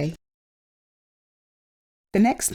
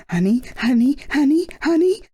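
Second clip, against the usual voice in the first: greedy glee; 7 semitones higher than usual. A narrator's reading. Norman finding the secret honey store, rising greedy glee. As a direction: gloat over the honey.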